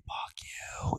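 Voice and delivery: breathy mock whisper of the insult; whispered curse. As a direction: hiss the curse under your breath, retelling it. Retold rude driver cursing.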